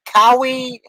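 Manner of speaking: Kawi, said loud with a wrong "ah" vowel; the guess Kawi with a broad "ah" in place of Kiwi's "ee"; mispronounced Kiwi. Shown as a demonstration. Fumbling the word for New Zealanders, says Kawi loud and wrong. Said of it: "I can never say it right".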